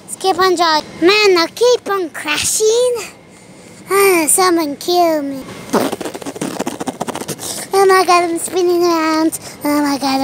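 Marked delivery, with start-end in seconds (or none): none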